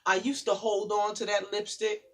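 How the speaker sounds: rhythmic spoken word, building in force; poem recital. Acted. Guest reading her poem to the audience, building spoken word.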